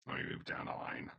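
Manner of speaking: normal talking voice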